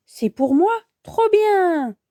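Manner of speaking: delighted cry, rising high. Acted. Sacha finds a parcel for him, delighted. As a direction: exclaim with joy at a present.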